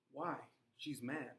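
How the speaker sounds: usual speaking voice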